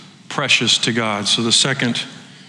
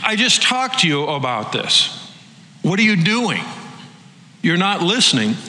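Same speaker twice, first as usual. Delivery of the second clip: exasperated Jesus; 5 semitones higher than usual. Retold or imitated. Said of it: "And he's like".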